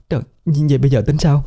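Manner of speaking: cao vút, nhanh, hoảng hốt; hốt hoảng hỏi dồn; hoảng sợ lắp bắp. Acted. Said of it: "ả kia hoảng sợ lắp bắp". (cô vợ hoảng sợ hỏi tình nhân, giọng cao và gấp)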